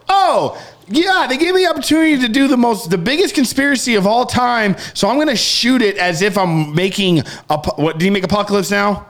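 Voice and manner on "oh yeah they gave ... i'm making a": higher, fast, hammed-up smug director; mock Stanley Kubrick. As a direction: play a cocky director bragging. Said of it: "Stanley Kubrick's gonna be like".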